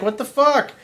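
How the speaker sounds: high, startled cry of the stung frog; shocked outburst. Retold or imitated. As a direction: yelp it out in disbelief. Stung frog in retold fable.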